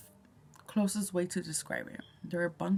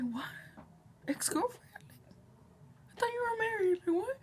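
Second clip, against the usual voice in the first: high, incredulous squeak of surprise; much higher, stunned and questioning; shocked disbelief; 4 dB quieter, faster than usual. Retold or imitated. Shocked reaction retold.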